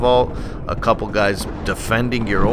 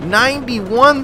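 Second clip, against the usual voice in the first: high, quicker repeat of ninety-one; incredulous; 12 semitones higher, 3 dB louder, slower than usual. Real, not acted. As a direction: echo it again, still stunned. Shocked at score.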